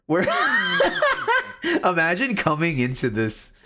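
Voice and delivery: high, helpless laugh running into the words. Real, not acted. Streamer amused at what a newcomer would hear, laughing. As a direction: burst out laughing, then talk through it.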